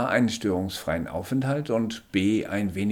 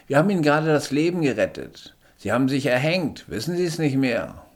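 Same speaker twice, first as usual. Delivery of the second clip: höher und lauter, bestimmter Sanitäter; 7 semitones higher, 5 dB louder, faster than usual. Acted. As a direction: fordert den Patienten energisch auf liegen zu bleiben. Sanitäter erklärt dem Patienten. (Sanitäter beugt sich über den Patienten, höher und lauter)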